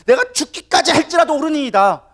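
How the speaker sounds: high, loud defiant outburst; angry Jonah. Acted. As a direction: hurl the answer back indignantly. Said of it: "막 화를 내는 것이 옳다라고".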